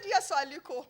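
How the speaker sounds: high, loud plea; pleading yes. Retold or imitated. Woman begging businessman to come, high loud plea. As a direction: beg him, voice up high.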